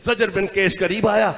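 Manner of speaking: usual speaking voice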